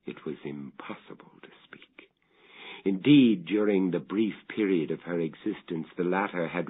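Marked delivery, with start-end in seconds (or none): 0.1-2.1 a soft, breathy whisper; hushed whisper. A narrator's reading. Father explaining why he never spoke of the mother, whispering. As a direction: confess it as though you dare not say it. Narrator recalls unspeakable grief.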